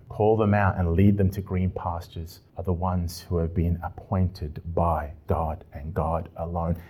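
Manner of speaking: normal talking voice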